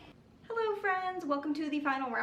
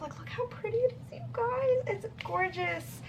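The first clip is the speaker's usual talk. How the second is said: tearful excitement; 11 semitones higher than usual. Real, not acted. Thrilled over gifted book.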